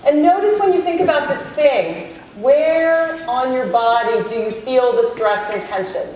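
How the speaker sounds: guided meditation. Acted. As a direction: ease the room gently inward, unhurried. Leads a self-compassion meditation.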